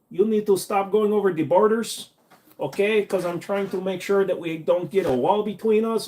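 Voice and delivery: high, pushy scolding wife. Retold or imitated. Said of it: "She gonna sta".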